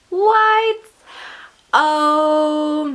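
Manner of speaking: high, loud startled what; thrilled disbelief; surprised. Real, not acted. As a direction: react with delighted shock. Surprised by next month's theme.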